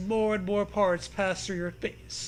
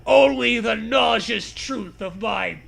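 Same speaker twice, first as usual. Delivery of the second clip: slow dramatic declaiming; 5 dB louder, much slower than usual. Acted. Dramatic zombie-book monologue.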